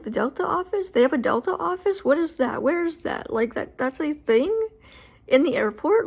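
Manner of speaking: high, baffled run of questions; incredulous. Retold or imitated. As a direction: retell the disbelief as the pitch jumps up. Retold shock at hearing of office.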